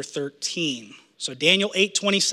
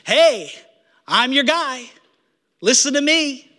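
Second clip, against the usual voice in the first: higher, louder pitchman voice calling out; mock false messiah; 7 semitones higher, 3 dB louder than usual. Preacher mimics a false messiah luring the crowd, hammed-up and higher. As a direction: play a slick fake saviour selling himself. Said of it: "false messiahs all over the place saying".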